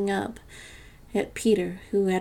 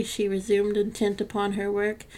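ordinary delivery

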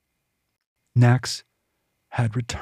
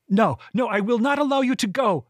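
high desperate shout; 10 semitones higher than usual. A narrator's reading. Kyla shouts as he escapes.

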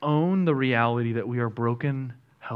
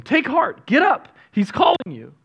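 eager crowd call; 10 semitones higher, 5 dB louder than usual. Acted. Crowd calls blind man, reread.

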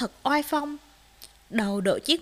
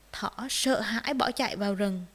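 ordinary delivery, nothing marked

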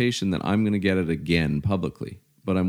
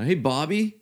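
high-pitched mock holler; retold screaming; 8 semitones higher than usual. Retelling the leader's name-calling on the phone, mock yell. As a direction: imitate a taunting caller, pitched well up. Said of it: "he's just screaming at me".

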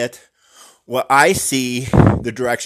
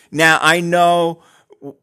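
ordinary delivery

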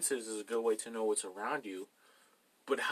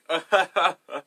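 loud, higher staccato laugh; hammed-up belly laugh, ha-ha-ha; put-on booming laughter; imitated laugh; 7 semitones higher, 14 dB louder than usual. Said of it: "he starts laughing".

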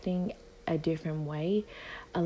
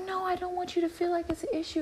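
mocking coworker voice; 13 semitones higher, faster than usual.

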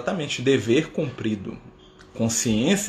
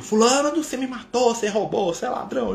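accusing spirit; 10 semitones higher than usual. Retold or imitated. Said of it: "o espírito chegou".

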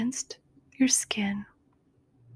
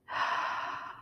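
deep sigh; 10 dB quieter than usual. Shown as a demonstration. Breathing exercise inhale, exhale.

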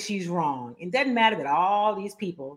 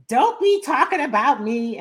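retold yell; 7 semitones higher, 6 dB louder than usual. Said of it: "She yells".

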